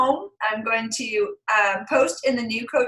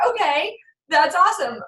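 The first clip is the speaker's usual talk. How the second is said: excited praise; 6 semitones higher than usual. Retold or imitated. Praising son's reading.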